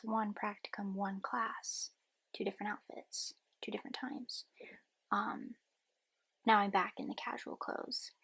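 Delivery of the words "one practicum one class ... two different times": breathy whisper to the camera; hushed whispered recap. Real, not acted. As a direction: whisper it, barely voiced. Whispered vlog lines.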